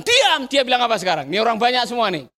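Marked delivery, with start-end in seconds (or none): 0.0-0.5 high, short command; angry crowd. Acted. Recalling the crowd's earlier order, high angry command. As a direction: order silence, pitched up. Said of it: "orang banyak yang tadi bilang".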